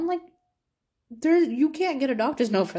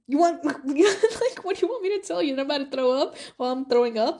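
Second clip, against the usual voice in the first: high, incredulous laugh through the words; amused, exasperated disbelief; 5 semitones higher, 3 dB louder than usual. Real, not acted. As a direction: laugh at how absurd it is. Mocking the teacher's demand.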